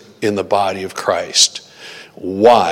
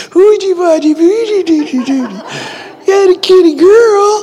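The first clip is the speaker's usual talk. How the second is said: put-on very high, squeaky baby talk to a cat; 4 dB louder than usual. Imitating his wife cooing at the cat, squeaky baby talk. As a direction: coo at the kitty like a baby. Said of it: "Brenda reverts into baby talk".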